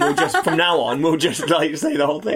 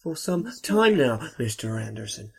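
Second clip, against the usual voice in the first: Agent Smith impression; 4 semitones lower, 5 dB quieter than usual. Quoting Agent Smith slowly.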